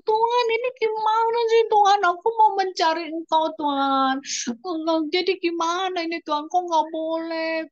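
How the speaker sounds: high, loud pleading cry to God; crying prayer. Retold or imitated. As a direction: beg God through tears. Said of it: "Ku bilang begitu". (doa sambil menangis, tinggi dan keras)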